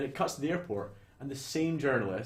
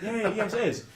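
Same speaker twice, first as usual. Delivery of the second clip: laughing; 8 semitones higher, 4 dB louder than usual. Retold or imitated. Journalist answers, laughing.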